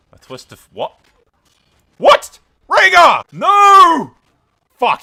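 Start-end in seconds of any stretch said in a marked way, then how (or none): 2.0-5.0 screaming in disbelief, much louder and higher; shocked scream. Real, not acted. Gamer seeing his brother killed, anguished scream. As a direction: howl no at the loss.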